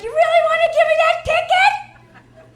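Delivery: high, loud, slow coy flirt; flirty coy plea.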